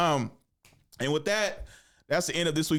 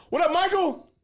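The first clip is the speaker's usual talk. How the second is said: hyped-up greeting; 5 dB louder than usual. Retold or imitated. Loudly greeting ex's boyfriend.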